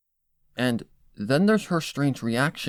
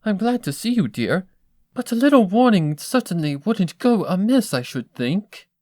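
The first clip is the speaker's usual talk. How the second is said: higher refined voice, gently scolding; a lady chiding a visitor, louder; prim Rarity; 8 semitones higher, 6 dB louder than usual. Acted. Rarity scolds Twilight for sneaking in, prim higher voice.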